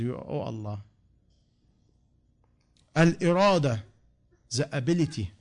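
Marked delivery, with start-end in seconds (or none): 0.0-0.8 much softer, airless plea; breathless gasp. Retold or imitated. Someone who cannot breathe calls on Allah, softly gasping. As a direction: squeeze it out with no breath left. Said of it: "when you cannot breathe".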